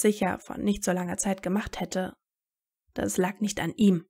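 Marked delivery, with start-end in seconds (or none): none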